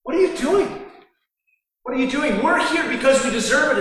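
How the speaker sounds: high, incredulous rebuke; rebuking. Retold or imitated. Criminal rebuking the other criminal, high and incredulous. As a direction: demand an answer from him. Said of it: "he's rebuking him".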